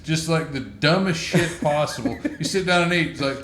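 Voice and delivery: ordinary delivery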